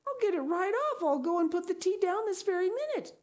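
high, frantic offer; distressed. Acted. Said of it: "cried Anne in distress".

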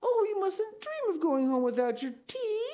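very high-pitched, dismayed pleading; distressed cry. Acted. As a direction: cry out in dismay at the guest leaving. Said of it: "cried Anne in distress".

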